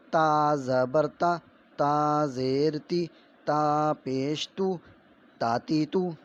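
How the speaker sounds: slow, loud sing-song recitation of letter sounds; syllable drill. Shown as a demonstration. Teacher drilling children in Urdu letter sounds, slow and loud. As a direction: chant each syllable slowly like a classroom primer.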